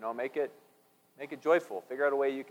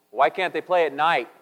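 louder blurted question; blurted punchline; 5 semitones higher, 10 dB louder than usual. Acted. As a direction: blurt it out loudly. Engineer's punchline.

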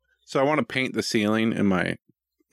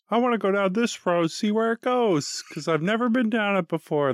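eager dad; 13 semitones higher than usual. Retold or imitated. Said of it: "I'm like".

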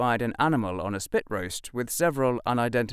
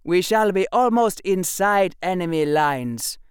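loud, high-pitched commanding officer; commander addressing troops; 9 semitones higher, 6 dB louder than usual. Acted. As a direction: bark orders to the ranks. Commander gives orders.

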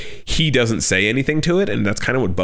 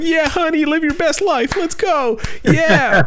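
put-on much higher sing-song old lady voice; doting old wife; slower than usual. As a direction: humour him like a patient wife.

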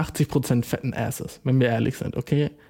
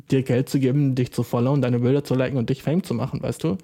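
normal talking voice

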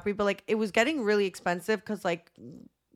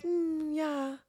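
soft, sly mm yeah; a quiet guilty confession; sheepish admission; 7 semitones higher, 6 dB quieter than usual. Retold or imitated. The Italian admitting he speaks English, soft and sheepish. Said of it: "He's like".

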